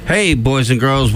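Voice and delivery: usual speaking voice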